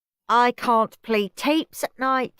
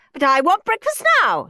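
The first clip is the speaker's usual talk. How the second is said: demanding whine; 10 semitones higher, 7 dB louder than usual. Acted. Margaret demanding food.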